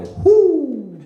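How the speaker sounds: loud high whoop from the crowd; whooping shout. Retold or imitated. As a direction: let out a drunk yell. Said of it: "I heard a dude go".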